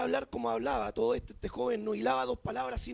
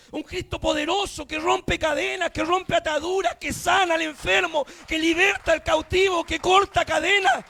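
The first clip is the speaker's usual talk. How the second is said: loud, driving Pentecostal preaching; fervent shouted sermon climax; full-force preaching; 4 semitones higher, 11 dB louder than usual. Real, not acted. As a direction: proclaim it in mounting shouts to a hallelujah.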